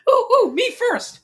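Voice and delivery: very high, loud squeal; excited shout. A narrator's reading. Pinky begs to ask first, shouting excitedly. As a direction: squeal it eagerly. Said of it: "Pinky goes up and shouts".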